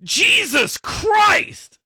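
high, loud, slow yell of Jesus Christ; a child blurting out a loud high yell; kid yelling. Retold or imitated. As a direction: shout it out like a startled kid. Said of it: "yells out".

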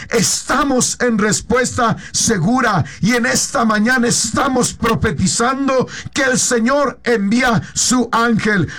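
usual speaking voice

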